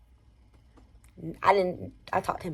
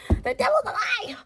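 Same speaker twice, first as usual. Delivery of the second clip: very high, squealed exclamation; high-pitched outcry. Retold or imitated. Storyteller reliving getting out of that car, high dramatic squeal. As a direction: squeal it out, stretching the last word.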